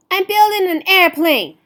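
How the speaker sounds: put-on high, loud proud girl's voice; girl defending her project; proud reply. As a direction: answer the bullies boldly. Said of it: "she told them".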